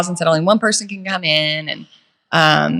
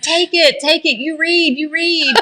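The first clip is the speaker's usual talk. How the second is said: frantic handoff; 10 semitones higher than usual. Retold or imitated. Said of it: "And then I'm just like".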